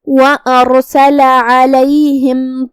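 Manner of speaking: slow, measured tajweed chanting; slow melodic Quran recitation. Shown as a demonstration. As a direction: draw out each vowel, melodic recitation. Teacher recites Quran verses.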